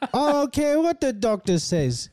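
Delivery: high, hammed-up Australian bloke voice; mock Aussie accent. Friend mocking streamer in high Australian accent. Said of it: "he's trapped in the accent".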